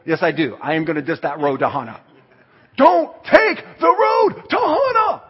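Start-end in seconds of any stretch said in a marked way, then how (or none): 2.8-5.2 loud, high, slow shouted warning; drawn-out shouted warning on voicemail; booming warning. Retold or imitated. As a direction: bellow it like an urgent warning.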